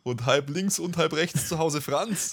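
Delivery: lineup call like a stadium speaker; stadium announcer. Pretend. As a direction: call the first names like an announcer. Reading lineup, announcer style.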